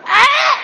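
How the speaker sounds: high shrieked "Ah!"; frazzled mom scream. Retold or imitated. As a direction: scream like a mom at the end of her rope. Mom losing it, wordless.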